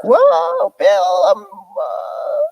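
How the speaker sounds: a woman losing her focus mid-word; mock dazed Hillary. Imitating Hillary's rolling eyes.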